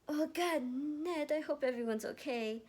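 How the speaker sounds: high, loud panicked cry; alarmed, fearing for friends; gasping worry. Acted. Erica panicking to Ned, high and loud. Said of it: "Erica gasped".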